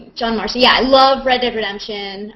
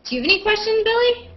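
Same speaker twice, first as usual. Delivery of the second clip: bright question; 12 semitones higher, faster than usual. Real, not acted. Asks the guest Billy a question.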